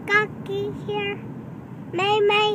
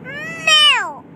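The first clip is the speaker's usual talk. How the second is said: loud playful meow; cat meow; 6 semitones higher, 5 dB louder than usual. Pretend. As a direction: meow it out cheekily. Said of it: "What's that cow say?".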